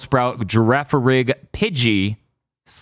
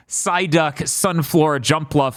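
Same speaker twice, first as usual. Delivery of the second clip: normal talking voice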